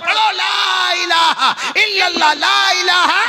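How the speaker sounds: loud, very high exhortation; ringing high-pitched preacher's call; full-force preaching. Real, not acted. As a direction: cry it out for all to recite.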